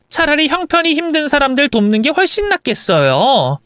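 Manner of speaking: lightly acted scornful tirade; contemptuous wife. Wife refusing to fund mother-in-law, contemptuous. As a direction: press the insult home.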